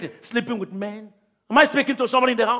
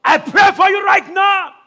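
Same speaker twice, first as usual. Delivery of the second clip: higher and louder, slow, fervent shouted prayer; preaching at full force; 10 semitones higher, 11 dB louder than usual. Acted. Pastor to congregation, praying over them, shouted preaching at full force.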